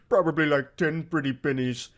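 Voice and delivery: lightly imitating a stuffed-up nerd; quick, mildly congested mimicry; mock congested voice. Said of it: "He sounds so fucking congested".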